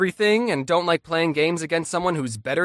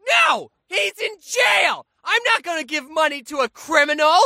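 high, loud squealing protest; hammed-up sibling tantrum; outraged kid yelling; 10 semitones higher, 3 dB louder than usual. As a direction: yell it like a child losing at a board game. Acted family board game quarrel.

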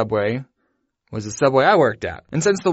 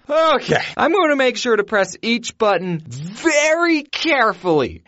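higher, slow, deliberate self-talk; mock careful; 7 semitones higher, slower than usual. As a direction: spell out each step with exaggerated care. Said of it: "So I go".

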